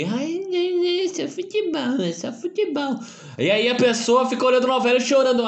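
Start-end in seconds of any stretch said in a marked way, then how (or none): 0.0-3.0 high whiny mock voice. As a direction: whine it like a crybaby. Mocking people who say it's just football. (voz fininha de deboche)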